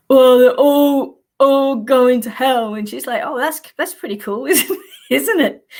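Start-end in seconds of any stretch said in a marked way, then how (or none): 0.1-2.7 slow, louder mock preacher caller; hammed-up caller warning of hell; funny caller voice. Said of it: "he had this real funny voice". 3.2-5.7 laughing. Retold or imitated. Partner mocks caller, laughing.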